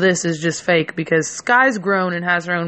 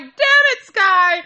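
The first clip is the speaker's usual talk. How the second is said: exasperated outburst; 11 semitones higher, slower than usual. Retold or imitated. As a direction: yelp at her for blowing the lie. Caught out, blames friend.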